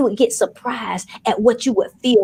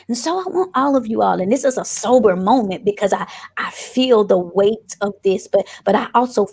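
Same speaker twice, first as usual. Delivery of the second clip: ordinary delivery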